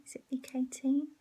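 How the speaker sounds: breathy unsure whisper. Real, not acted. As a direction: whisper the question to yourself. Unsure about the week number.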